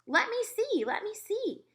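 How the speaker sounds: put-on high, pleading girlish voice; begging girls. A narrator's reading. Girls begging to see the mouse. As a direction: beg like eager little girls. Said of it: "they begged".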